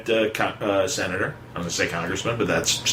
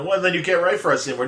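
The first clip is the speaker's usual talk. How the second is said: fast, higher ultimatum; mock boss; 8 semitones higher, faster than usual. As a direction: rattle off the boss's threat. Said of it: "You know".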